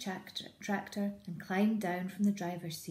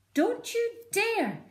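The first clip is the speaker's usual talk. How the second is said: much higher, slow stern warning; stern mother fox; 7 dB louder, slower than usual. Acted. Missus Fox warning her cub, high stern voice. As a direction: warn the cub firmly. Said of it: "said Missus Fox".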